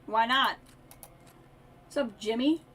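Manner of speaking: ordinary talk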